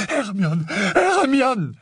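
high, rising desperate yell; Ron's frantic cry. A narrator's reading. Ron interrupting his friend to call her, frantic cry. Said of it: "hurla Ron".

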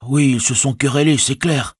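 high-pitched, forthright hobbit servant; plain-spoken Sam. A narrator's reading. Servant confirming the quarrel to his master, high and matter-of-fact. Sam's dialogue in narrated story.

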